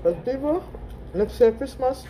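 eating a burger while speaking; talking while chewing. Real, not acted. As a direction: mouth partly full of food. Seen 2: bites burger at -7, chewing-like mouth with burger nearby early on.

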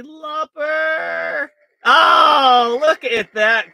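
loud thrilled outburst; excited shout. Real, not acted. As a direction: shout with delight. Reacting to wheel result.

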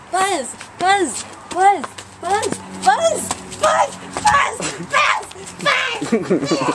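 high, loud buzzing fly; buzzy housefly. Pretend. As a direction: buzz it out like a frantic fly. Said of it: "This is my impression of a fly".

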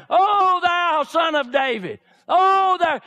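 high, loud pleading cry; desperate cry out. Acted. Blind Bartimaeus calling out to Jesus, loud high desperate cry. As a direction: cry out to be heard over the crowd.

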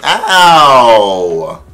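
loud, long falling wail; imitated singer's "ow!". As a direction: burst into a sung "ow". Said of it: "go from talking like that to".

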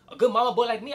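ordinary delivery

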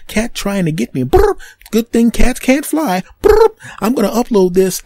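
high, chirpy bird voice trilling brr; a bird hosting its own vlog; bird vlogger. Retold or imitated. Bird filming the cat for his vlog, chirping high. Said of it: "he goes".

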